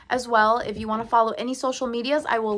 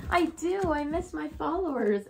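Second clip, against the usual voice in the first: higher-pitched voice for a pet dog; dog's voice; 7 semitones higher than usual. Acted. Owner speaking for her dog about missing followers, high pet voice. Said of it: "He's like".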